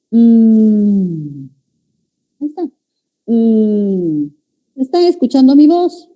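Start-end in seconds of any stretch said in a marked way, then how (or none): 0.1-1.4 long voiced hum on "Mm"; hummed M. Shown as a demonstration. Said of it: "y digo". 3.2-6.1 hum, then ask brightly whether they hear the voice; humming then asking. Shown as a demonstration. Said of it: "articulo solo, aislado, sin vocal".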